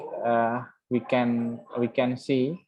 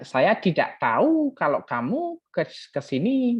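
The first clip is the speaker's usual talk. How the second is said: lilting Balinese accent, pitch jumping up; 5 semitones higher than usual. Shown as a demonstration. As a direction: imitate a Balinese speaker's swooping tune. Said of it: "misalnya itu accent Bali". (logat Bali yang mengayun)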